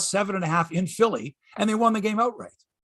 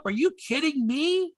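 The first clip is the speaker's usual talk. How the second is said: incredulous disbelief; 8 semitones higher, slower than usual. Real, not acted. Incredulous at a Leafs sweep claim.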